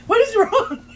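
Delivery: high, loud mock bewilderment; baffled outcry. Host acting out her confusion at the story, high baffled cry. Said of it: "I was like".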